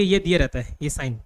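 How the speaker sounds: usual speaking voice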